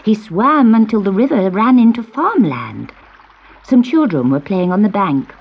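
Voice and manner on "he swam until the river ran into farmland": ordinary delivery, nothing marked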